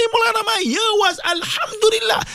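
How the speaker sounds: high, bubbly voice of a happy caller; cheerful listener voice. Acted. Host mimics a grateful listener to the Maulana, high and gleeful.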